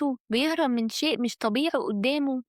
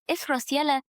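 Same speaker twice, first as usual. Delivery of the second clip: ordinary delivery, nothing marked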